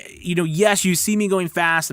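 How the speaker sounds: ordinary delivery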